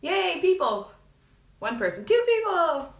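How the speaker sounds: high, bright burst of delight; excited squeal. Real, not acted. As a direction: squeal with joy as people arrive. First viewers join the live.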